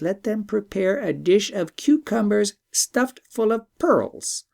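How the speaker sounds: a lightly voiced wise bird; talking bird.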